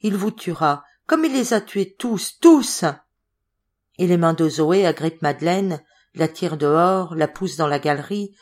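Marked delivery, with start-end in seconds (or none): 0.0-2.8 frantic warning. Acted. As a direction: scream the last word. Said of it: "on n'arrête plus la voix de Zoé". (avertissement affolé qui finit en cri)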